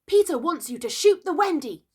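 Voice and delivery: shrill, high fairy call; ringing reply. A narrator's reading. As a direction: ring it out loud and high. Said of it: "Tink's reply rang out".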